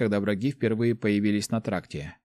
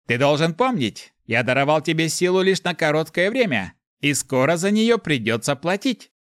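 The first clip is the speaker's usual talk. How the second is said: high demon voice, precisely articulated; Mefis, stern reminder; 6 semitones higher, 3 dB louder than usual. A narrator's reading. Inner demon warning the hero he must pay, clear reminder. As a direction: remind him of the debt, each word clear. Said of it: "лишь спокойное, предельно чёткое напоминание".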